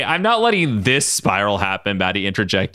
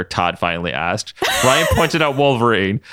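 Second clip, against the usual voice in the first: ordinary delivery, nothing marked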